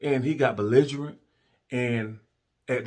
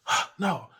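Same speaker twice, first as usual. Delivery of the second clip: feigned innocence; 7 semitones higher than usual. Retold or imitated. Partner's denial acted out.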